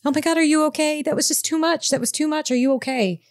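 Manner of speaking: high, anxious rush of concern; worried. Acted. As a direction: fuss over her in a panic. Character rushes to fainted friend.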